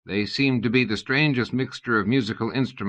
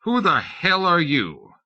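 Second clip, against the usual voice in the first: put-on drunk poet demanding, higher and slow; a tipsy demand with mock dignity; drunken poet demanding to know who the stranger is; owlish dignity; 8 semitones higher, 3 dB louder, slower than usual. A narrator's reading. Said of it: "with owlish dignity demanded".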